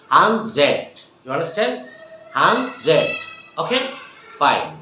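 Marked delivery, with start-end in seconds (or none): 0.1-0.8 "হান্ড্রেড" said loudly in two beats, "han-zred"; repeat-after-me pronunciation. Shown as a demonstration. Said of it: "আমার সাথে সাথে বলো তো সবাই". 2.3-3.1 "হান্ড্রেড" loud, split as "han-zred"; repeat-after-me pronunciation. Shown as a demonstration. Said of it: "আমরা বলবো হান্ড্রেড".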